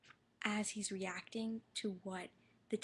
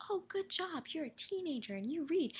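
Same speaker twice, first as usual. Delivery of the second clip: patronizing praise; 7 semitones higher than usual. Retold or imitated. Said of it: "healthy manner where it's like".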